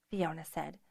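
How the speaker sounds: ordinary delivery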